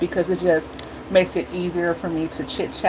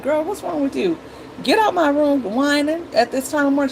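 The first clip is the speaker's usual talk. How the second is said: higher, sharp mom telling off; exasperated scolding; 9 semitones higher than usual. Retold or imitated. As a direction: shoo the whining kid out, fed up. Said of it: "I can talk to her like that".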